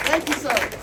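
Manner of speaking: usual speaking voice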